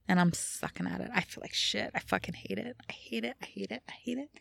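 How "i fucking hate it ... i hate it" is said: fading despair. Real, not acted.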